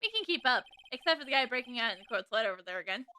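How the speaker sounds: ordinary talk, nothing marked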